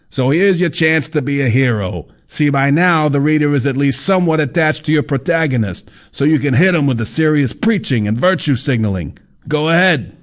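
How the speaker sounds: high, brash know-it-all; fat man voice. A narrator's reading.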